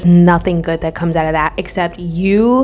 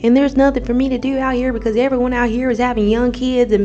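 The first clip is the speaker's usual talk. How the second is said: put-on accidental Midwest girl accent; 5 semitones higher than usual. Vlogger imitating a Midwest viewer in an accent. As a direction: slip into a playful gossipy girl accent. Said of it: "I don't know where that accent came from".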